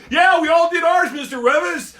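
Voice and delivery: put-on high, loud chorus of kids; smug class shout. Classmates to the teacher, a loud smug shout. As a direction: yell it to show him up.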